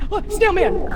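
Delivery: high startled call; alarmed cry. Real, not acted. Spotting the snail, alarmed cry. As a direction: yelp at the sight.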